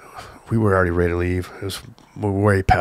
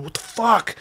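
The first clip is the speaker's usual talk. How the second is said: high, startled what-the; shocked outburst; 13 semitones higher, slower than usual. Retold or imitated. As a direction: retell Tony's shock at being slapped. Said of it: "Tony reacts to sucker punch".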